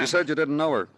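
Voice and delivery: sharp accusation. Acted. Detective confronts Alex.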